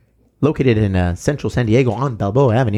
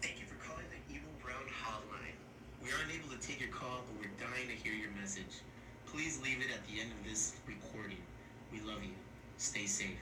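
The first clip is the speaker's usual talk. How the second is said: faint playback of the recorded greeting; tinny phone playback from far off; greeting played back; 3 semitones lower, 30 dB quieter than usual. Shown as a demonstration. Hosts listen back to their new hotline greeting, faint through the phone speaker. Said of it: "let's see how that came out".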